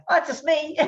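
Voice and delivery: imitating her own upbeat everyday voice. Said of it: "talking like this and sort of more upbeat".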